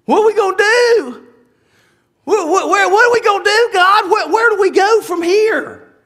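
high, stammering plea to God; hammed-up helpless, high-pitched questioning; mock panic. Acting out panicked believers questioning God.

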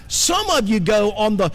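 ordinary delivery

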